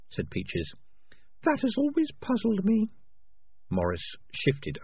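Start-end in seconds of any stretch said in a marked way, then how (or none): 1.4-2.9 very high, thin rat voice; tiny high-pitched rat, pointed; prim female rat. Acted. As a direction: squeak it out as a small rat. Peaches the rat's put-on voice.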